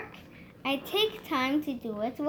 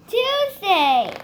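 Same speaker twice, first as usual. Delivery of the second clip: loud, higher call-out; speaking up; 5 semitones higher, 8 dB louder than usual. Shown as a demonstration. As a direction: call it out clearly. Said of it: "we speak up".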